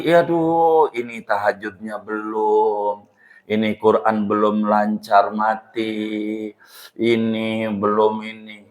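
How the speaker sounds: low, soft, slow lament of a worried soul; fretting dead man. Retold or imitated. Dead man frets to the preacher about unfinished prayers, slow and soft. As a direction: sigh it out like someone caught unready.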